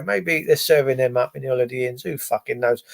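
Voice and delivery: ordinary talk, nothing marked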